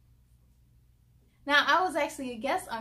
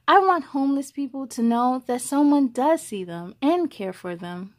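clear, even read-aloud voice; story narration; 11 dB louder than usual. A narrator's reading. Host reading a picture book to young viewers, storytelling voice.

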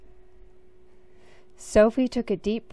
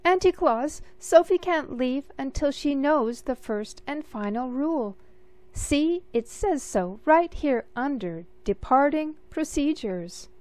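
higher, anxious little official; a fretful clerk pointing at the rules; fussy Mister Pudding; 5 semitones higher than usual. Acted. Mister Pudding insisting to Auntie Claus about the rule, fussy and anxious.

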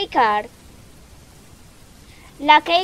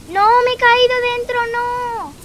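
dismayed wail; 10 semitones higher, 8 dB louder than usual. Real, not acted. Player falls in mid-escape, wailing in dismay. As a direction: wail a long, high "no" in dismay.

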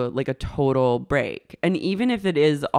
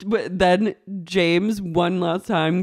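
normal talking voice

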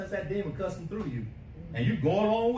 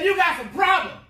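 much higher, loud call-out; accusing shout; 10 semitones higher, 8 dB louder than usual. Retold or imitated. The thing in the room calling him out, a loud high accusation. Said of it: "that thing right there, said".

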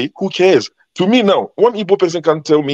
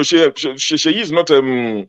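normal talking voice

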